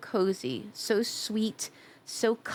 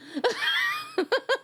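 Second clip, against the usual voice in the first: shrieking giggle up high; high squealing laugh. Real, not acted. Laughing at chat.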